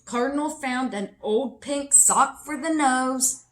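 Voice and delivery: ordinary delivery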